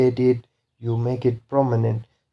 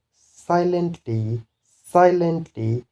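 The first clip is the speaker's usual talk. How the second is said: SI-lently, high drawn-out first syllable in silently; first-syllable stress; 5 semitones higher, slower than usual. Shown as a demonstration. Teacher shows the LY rule, high stress on SI in silently. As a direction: slowly, pitch up on SI in silently. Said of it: "stress is on the first syllable".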